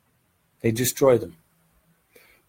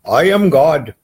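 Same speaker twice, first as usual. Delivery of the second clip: louder, higher self-proclaimed god; mock proud claim; 4 semitones higher, 9 dB louder than usual. Spiritual teacher mocking believers, in a loud grand claim. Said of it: "the enlightened sages".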